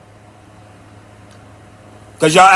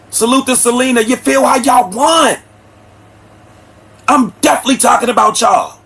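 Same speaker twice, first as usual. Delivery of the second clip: defiant call-out; 5 semitones higher than usual. Real, not acted.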